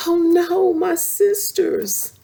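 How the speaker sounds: high, loud alarmed cry. Acted. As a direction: gasp it out in alarm. Sisters drifting toward waterfall.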